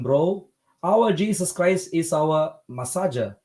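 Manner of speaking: ordinary talk, nothing marked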